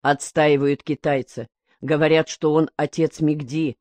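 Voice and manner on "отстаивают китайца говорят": plain reading voice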